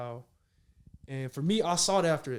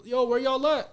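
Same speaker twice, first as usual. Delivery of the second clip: louder, higher call-out; retold yell; 8 semitones higher, 8 dB louder than usual. Lost player searching the hotel for the team, retold yell. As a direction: call out loudly for missing teammates, retelling it. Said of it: "And I'm yelling".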